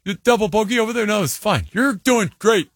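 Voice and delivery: high, sing-song mock encouragement; overeager reassurance. Parent cheering on a kid after a double bogey, high and overeager.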